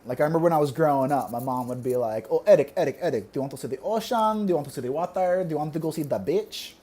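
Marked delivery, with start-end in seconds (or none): 2.3-6.7 put-on mom's softly urged Filipino-accented questions; thick Filipino accent. Mom asking her son about outings, thick Filipino accent. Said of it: "she has a really thick accent".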